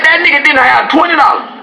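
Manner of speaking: high, laughing outburst; amazed laughing exclamation; incredulous. Acted. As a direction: cry out in disbelief. Laughing disbelief.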